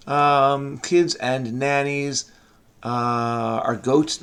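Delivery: usual speaking voice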